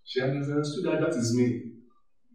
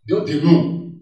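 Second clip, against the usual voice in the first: ordinary delivery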